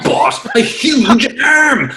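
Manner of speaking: drawn-out, high shout on the big reveal; hammed-up storybook climax; dramatic comic reveal.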